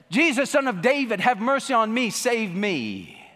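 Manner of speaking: crying out. Retold or imitated. Blind Bartimaeus calling to Jesus, crying out loud. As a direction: beg loudly for mercy. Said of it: "cried out with blind Bartimaeus".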